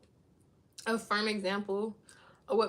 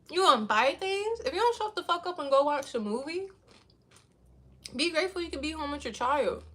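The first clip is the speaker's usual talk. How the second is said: mom's retort; 4 semitones higher, 3 dB louder than usual. Retold or imitated. Mom mocking back.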